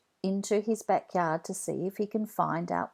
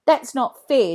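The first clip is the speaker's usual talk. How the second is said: loud upset protest; shouting; 5 semitones higher, 8 dB louder than usual. Acted. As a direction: shout it, hurt and angry. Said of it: "Jack shouts".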